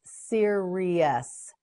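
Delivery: "Serious" slowly as seer, ee, us; syllable by syllable. Shown as a demonstration. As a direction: stretch each syllable of "Serious". Teacher models word by syllables.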